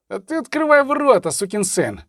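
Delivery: high-pitched angry bark, dropping at the curse; rough command with a curse; barked order. A narrator's reading. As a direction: bark it impatiently at the gatekeeper. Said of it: "гаркнув Федор".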